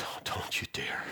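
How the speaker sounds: sharp whisper: don't you dare; whispered rebuke. Retold or imitated. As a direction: hiss it as a warning.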